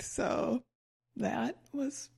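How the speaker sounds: slow, dry whispered sarcasm; sarcastic whisper. Real, not acted. Grumbling to her co-host about migraine costs, dry whisper. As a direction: whisper it drily, slowly.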